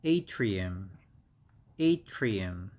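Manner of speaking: ATRIUM with a stressed long AY at the start; model pronunciation. Shown as a demonstration. Teacher showing how this one is pronounced, careful model. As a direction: say ATRIUM carefully as AY-tree-um, falling tune. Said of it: "We pronounce this one as".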